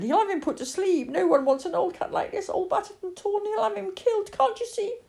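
put-on high childish wail, rising as it goes; distressed pleading. Lily tells her mother the cat will die, climbing distress. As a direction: plead desperately for the cat's life.

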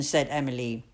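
plain reading voice

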